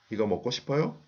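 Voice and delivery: 이거 먹고 싶어요 lifting upward on the final 요; rising question intonation. Shown as a demonstration. Teacher demonstrates a question to learners, 이거 먹고 싶어요 with rising intonation. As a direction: ask 이거 먹고 싶어요 with the pitch going up at the end. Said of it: "the intonation goes up".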